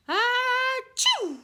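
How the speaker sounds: loud high sneeze, Achoo! belted out; huge sneeze. Acted. Wolf sneezing the straw house down, huge sneeze. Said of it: "a great sneeze".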